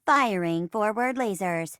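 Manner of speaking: even robot computer acknowledgement; robot assistant. Acted. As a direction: confirm the order like a ship's computer. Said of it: "I'm your robot assistant".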